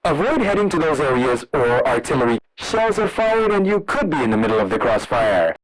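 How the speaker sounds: ordinary talk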